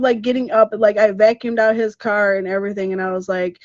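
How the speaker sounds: ordinary delivery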